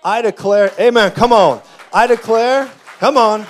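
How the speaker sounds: loud, high declarations to the crowd; preaching at full force. Real, not acted. Preacher declaring over the congregation, shouting. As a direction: proclaim it like a preacher at full cry.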